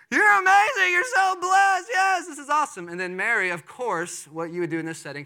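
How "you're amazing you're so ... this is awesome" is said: high, loud gushing shout; excited yelling. Retold or imitated. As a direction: yell it out in giddy joy. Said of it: "starts yelling at Mary".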